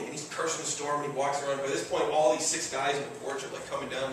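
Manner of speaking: usual speaking voice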